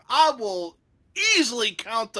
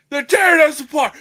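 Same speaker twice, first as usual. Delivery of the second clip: loud, higher, fast mock wail; whiny Kurt Angle; 7 semitones higher, 10 dB louder, faster than usual. Friend imitating Kurt Angle complaining to Stone Cold, loud mock wail. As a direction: wail it like the team is falling apart. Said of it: "He goes to Kurt Angle".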